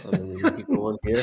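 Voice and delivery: laughing high through the words. Real, not acted. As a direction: laugh while teasing. Teasing joke, laughing.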